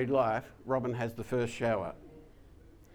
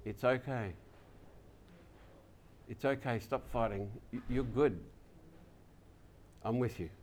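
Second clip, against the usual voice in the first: softer, hushed reassurance from God; soft heavenly voice; 2 semitones lower, 8 dB quieter than usual. Acted. Preacher softly voicing God's comfort to worshippers, hushed. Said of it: "hear the voice that says".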